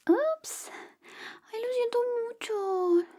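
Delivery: sudden high yelp of oops, then sorry; a slip of the razor, caught off guard; surprised oops. Acted. Barber to friend after nicking him, surprised yelp. Nicks his friend's moustache.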